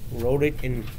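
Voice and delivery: ordinary delivery, nothing marked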